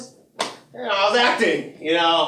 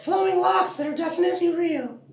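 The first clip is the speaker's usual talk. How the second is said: Pam; 12 semitones higher than usual. Acted. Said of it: "I'm Pam now".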